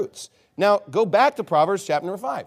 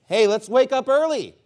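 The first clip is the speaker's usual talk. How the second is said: higher, a hint of an eager wife; lightly voiced wife; 8 semitones higher, 4 dB louder than usual. Preacher voicing the wife talking to the husband, lightly acted, higher. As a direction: lightly act a wife's invitation, pitched up. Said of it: "this is the wife again talking to the husband".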